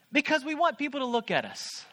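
ordinary talk

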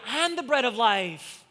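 loud, high, slow proclamation; preacher voicing a slow, ringing declaration; bold declaration. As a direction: declare it loudly, drawing the words out. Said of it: "Then Jesus declared".

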